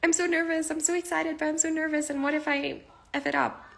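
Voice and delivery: high, breathless jittery squeal; nervous excitement. Retold or imitated. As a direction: gush like you're about to go live. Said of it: "I'm like".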